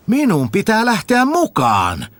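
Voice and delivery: high, eager simpleton voice; a high-voiced village fool asking questions; Tuhma Jussi asking. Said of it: "sanoi Tuhma Jussi".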